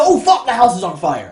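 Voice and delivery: loud, high burst of acted alarm; alarmed realization. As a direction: cry out as it suddenly dawns. Ghost realizes house is burning.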